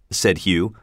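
usual speaking voice

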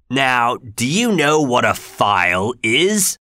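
slow, rough question; menacing convict. Acted. Convict questioning Pip while tipping him back, slow. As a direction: lean over the boy, slowly.

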